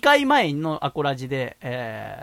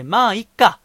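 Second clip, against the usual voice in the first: louder, clear and firm; 6 semitones higher, 8 dB louder, slower than usual. Shown as a demonstration. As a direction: say it out loud to let go. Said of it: "声に出して".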